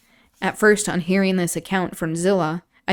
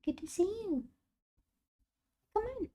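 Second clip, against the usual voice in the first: high, sweet voice for a pet; high cooing greeting; pet talk; 7 dB quieter than usual. Real, not acted. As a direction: coo at the dog coming over.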